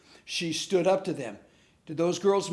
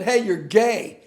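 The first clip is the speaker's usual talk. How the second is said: raised, loud retold taunt; mock taunting yell; 4 semitones higher, 7 dB louder, slower than usual. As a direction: call it out like a teasing schoolboy, half-yelled. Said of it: "one guy yelled out".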